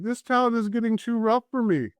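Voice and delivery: high-pitched bartender voice; bartender impression. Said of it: "he's like".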